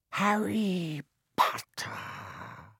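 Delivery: very slow, eerie villain whisper. A narrator's reading. The face greets Harry by name, whispering. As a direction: breathe the name out in a long whisper. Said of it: "it whispered".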